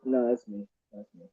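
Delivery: muffled voice, like through a wall. Real, not acted. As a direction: sound muffled and far off. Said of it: "Bro, you sound muffled as hell".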